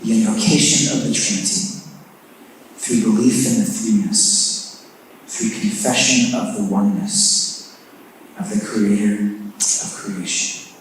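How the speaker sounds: hushed congregational prayer. Acted. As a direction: pray it quietly and reverently, dropping low. Opening prayer before introducing speaker.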